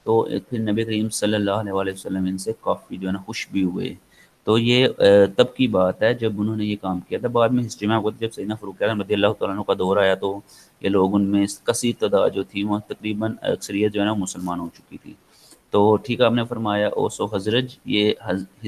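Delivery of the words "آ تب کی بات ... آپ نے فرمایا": ordinary talk, nothing marked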